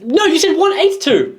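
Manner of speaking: high-pitched playful accusation; mock outrage. Hosts bickering over band's number, mock outrage.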